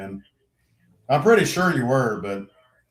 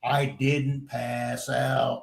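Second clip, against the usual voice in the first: slow, softer, word-by-word denial; deliberate denial; 6 dB quieter, much slower than usual. Retold or imitated. Player correcting his helpers, slow deliberate denial. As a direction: spell it out slowly at normal pitch.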